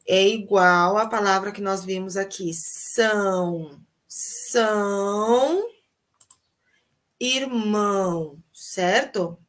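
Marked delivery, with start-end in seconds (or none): none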